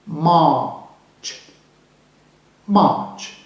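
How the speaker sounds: March with no R sound, the vowel drawn out; silent R. Shown as a demonstration. Teacher showing learners the silent R, "March" without R. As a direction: say "March" as "maach", dropping the R. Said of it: "we do not pronounce the re".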